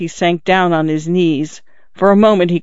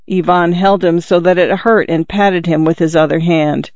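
usual speaking voice

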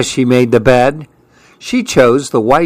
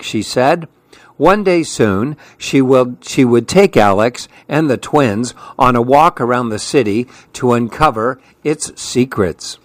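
usual speaking voice

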